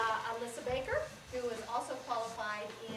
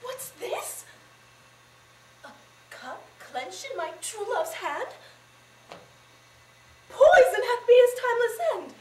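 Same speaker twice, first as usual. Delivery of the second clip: high, building theatrical grief; high-pitched tragic lament; anguished Juliet; 12 semitones higher, 14 dB louder, slower than usual. Acted. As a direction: build from stricken question to loud cry. Juliet finds the poison cup.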